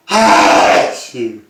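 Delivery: big explosive sneezes; loud sneezes. Real, not acted. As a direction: sneeze hard, one after another. Filming an afternoon sneezing fit.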